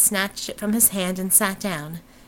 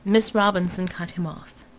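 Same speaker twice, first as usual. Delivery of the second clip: ordinary delivery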